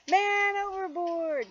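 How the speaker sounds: high, loud, slow shout of alarm; sailors' cry. Acted. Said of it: "the sailors cried".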